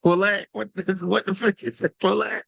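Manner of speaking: Polak rising, POH-lack with a laugh; mock northern pronunciation. Shown as a demonstration. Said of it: "That's my northern accent".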